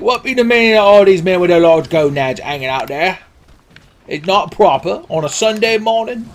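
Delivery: a hammed-up British bobby accent; old-timey British bobby. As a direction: scold like a fussy British policeman. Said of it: "it's the old-timey British Bobby".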